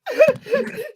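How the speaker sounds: loud, very high-pitched laugh. Real, not acted. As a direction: burst out in a high, loud cackle. Reacting to a joke comment.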